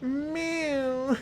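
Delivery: high imitated meow; squeaky mock meow; cat meow. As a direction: mimic the cat's little meow. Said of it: "looked up at me and went".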